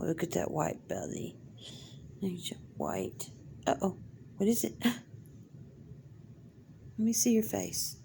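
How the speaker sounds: ordinary talk, nothing marked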